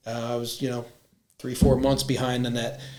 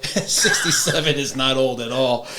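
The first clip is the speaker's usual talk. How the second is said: louder, laughing insistence; 5 dB louder than usual. Real, not acted. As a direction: laugh it off, emphatic.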